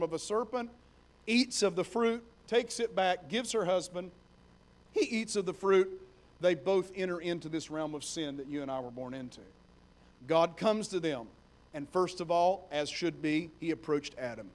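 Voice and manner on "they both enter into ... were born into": ordinary talk, nothing marked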